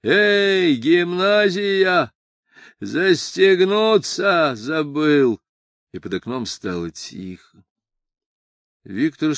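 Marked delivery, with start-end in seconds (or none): 0.0-5.4 high, slow, drawn-out call; distant drunken shout with stretched vowels; calling from a distance. A narrator's reading. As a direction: holler as if from far down the street. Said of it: "сказал уже где-то в отдалении".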